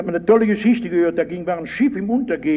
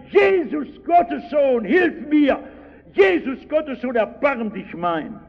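much higher, pleading shout; desperate cry; 8 semitones higher than usual. Retold or imitated. Blind beggar crying out to Jesus, high pleading shout. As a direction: cry out for help as if your life hangs on it. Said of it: "da fängt er an zu schreien".

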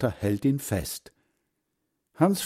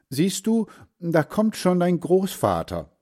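higher woman's voice, a touch triumphant; woman pointing out; 8 semitones higher than usual. A narrator's reading. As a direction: point him out, told you so. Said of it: "woman points at grandfather".

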